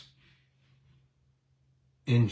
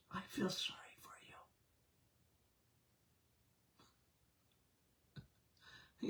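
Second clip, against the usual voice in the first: whispered aside, much softer; 2 semitones higher, 25 dB quieter, faster than usual. Real, not acted. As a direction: breathe it like a secret. Mock pity aside to audience.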